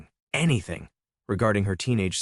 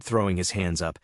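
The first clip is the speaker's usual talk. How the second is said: ordinary delivery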